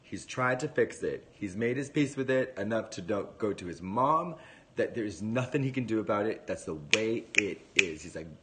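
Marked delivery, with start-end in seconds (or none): none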